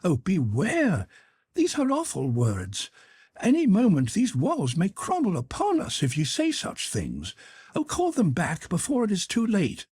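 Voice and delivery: high, frantic pleading; panicked boy, pitched well up; terrified. A narrator's reading. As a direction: beg him to take it back, voice up high. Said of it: "like to go out of his mind with fright".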